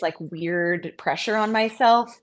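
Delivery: ordinary talk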